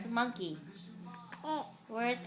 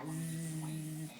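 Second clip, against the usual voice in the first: long, low, soft buzz; quiet drawn-out imitation of a bee; 7 semitones lower, 8 dB quieter than usual.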